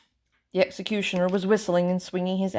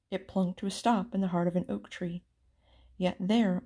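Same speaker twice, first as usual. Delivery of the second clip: ordinary delivery, nothing marked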